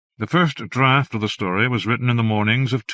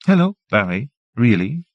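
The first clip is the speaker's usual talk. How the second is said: bright teasing repeat, a bit louder; playful echo; 3 semitones higher, 5 dB louder than usual. A narrator's reading. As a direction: echo his words back, amused. Janet playfully echoes his words.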